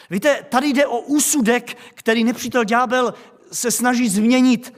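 loud, driving sermon voice; full-voiced pulpit proclamation; forceful preaching. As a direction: preach it out to the whole church.

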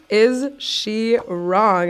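very slow, drawn-out "Is she wrong?"; slow rhetorical question. Real, not acted. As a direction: linger on it, challenging.

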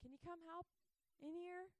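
high, pleading call for help; calling out to husband. Retold or imitated. Mom calling husband from the house, high and pleading. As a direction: call across to him, begging. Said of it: "she calls out to him".

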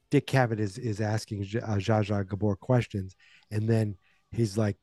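normal talking voice

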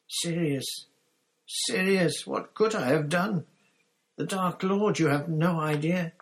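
high, loud, desperate whining; tearful pleading. A narrator's reading. Pettigrew begs Sirius to understand, tearfully. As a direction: wail out excuses in self-pity. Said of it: "burst into tears".

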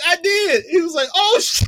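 high, loud laughing retelling; almost crying from laughter; giddy squealing laughter. Real, not acted. Excited retelling, cry-laughing.

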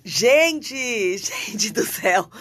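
ordinary delivery

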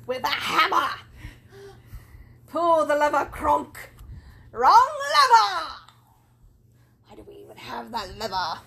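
loud, high cartoon villain quoting Yzma; Yzma impression. Quoting The Emperor's New Groove to her co-host, in a loud Yzma voice. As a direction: yell at Kronk like a frantic villainess. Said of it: "Apparently quoting The Emperor's New Groove".

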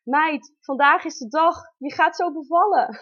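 excited announcement. Retold or imitated. Midwife telling the mother today is the day, excited. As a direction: bring the big news brightly. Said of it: "ik hoor haar zeggen".

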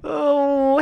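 ordinary talk

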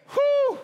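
overwhelmed preacher's whew; amazed whew. Real, not acted. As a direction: blow out a high, loud whew of awe. Overwhelmed after the verse.

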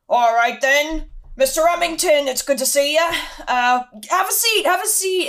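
big, loud, hearty host voice; Hagrid. Acted. Hagrid welcoming a student into his hut, loud and hearty. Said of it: "Hagrid, a moment later, walks in".

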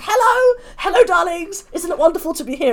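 high, theatrical hello to the whole room; loud gushing greeting. Acted. Small lady walks into the event, loud gushing greeting. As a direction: make a big darling-filled entrance, high and carrying. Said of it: "Can Be Heard Before Being Seen".

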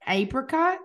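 drawn-out questioning APRICOT with long A; puzzled repeat. Real, not acted. Co-host queries the word, puzzled slow repeat. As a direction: say "apricot" as AY-pri-cot, slow, rising in doubt.

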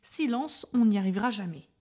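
loud, exasperated telling-off; scolding. A narrator's reading. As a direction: call for silence, crossly and loudly. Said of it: "Les hommes grondèrent".

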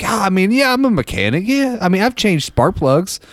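high, unsure fake confidence; bluffing guy. Bluffing guy claims mechanic skills.

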